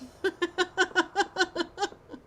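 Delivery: high, long cackle; real giggling after the joke; giggling fit. Real, not acted. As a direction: let the laugh roll on. Laughing at her own joke.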